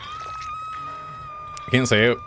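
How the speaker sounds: very high, very slow squeak; silly squeaky voice. Shown as a demonstration. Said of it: "without sounding like a total idiot".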